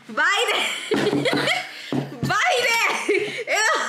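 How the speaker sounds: high shrieking laughter through the words; laughing shriek. Real, not acted. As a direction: cackle it out, giddy and high. Egging on her kid.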